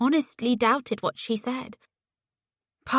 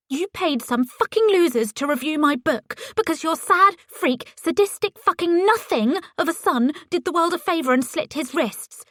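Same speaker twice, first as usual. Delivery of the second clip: rising, louder furious accusation; angry outburst; 7 semitones higher, 3 dB louder than usual. A narrator's reading. Ellie accusing Paisley over the phone, furious outburst. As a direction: let the rage erupt, pitch climbing. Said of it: "I felt an eruption coming".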